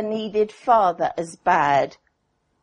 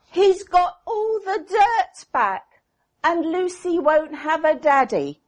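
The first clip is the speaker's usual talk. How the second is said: put-on tearful child; 8 semitones higher than usual. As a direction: sob out the girl's fear for her daddy. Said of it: "she burst into tears".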